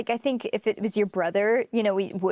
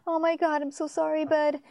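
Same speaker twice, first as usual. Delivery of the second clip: mock tearful apology; 6 semitones higher than usual. Retelling Ed begging the dead intern for help, high and mock tearful. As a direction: play it like a choked-up friend saying sorry.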